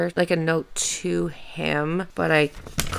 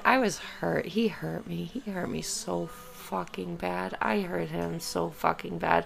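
quietly hurt; 9 dB quieter than usual. Real, not acted. Confessing hurt, read from her notes.

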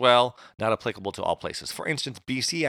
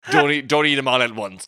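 ordinary delivery

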